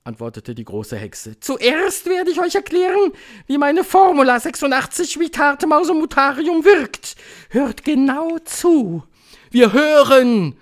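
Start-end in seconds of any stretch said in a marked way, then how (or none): 1.4-9.0 very high, loud witch voice; piercing high proclamation; commanding Grand High Witch. A narrator's reading. 9.5-10.6 high, loud, drawn-out crowd call; stretched eager shout; excited calling out. A narrator's reading. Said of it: "riefen die Tagungsteilnehmerinnen, die jetzt vor lauter Aufregung".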